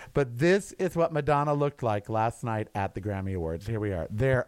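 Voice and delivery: ordinary talk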